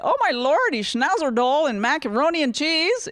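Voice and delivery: gushing put-on English exclamation; mock exclamation.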